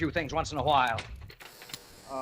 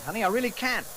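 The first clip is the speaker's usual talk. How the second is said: pleading; 9 semitones higher than usual. Acted. Pleading with wife.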